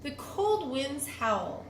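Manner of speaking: usual speaking voice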